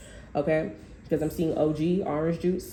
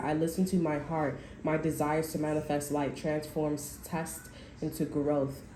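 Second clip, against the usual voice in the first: affirmation reading; 2 semitones lower, 5 dB quieter than usual. Acted. Reader reciting a card's affirmation to viewers, soft and steady. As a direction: read the card's message gently, like a mantra.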